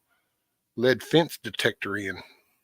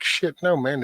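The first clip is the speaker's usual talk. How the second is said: higher-pitched imitation of a reluctant dog; dog's voice; 5 semitones higher than usual. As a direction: play the dog refusing, pitched up. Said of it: "To him, it's like".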